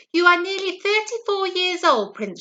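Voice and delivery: mildly voiced nagging queen. A narrator's reading. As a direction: scold your grown son like a royal mother. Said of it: "she said".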